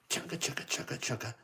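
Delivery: whispered chugga chugga train sound; breathy choo-train chug for kids; train chugging. Shown as a demonstration. Reading to kids, imitating the engine chugging softly. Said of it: "Thomas chugs towards the repair shed".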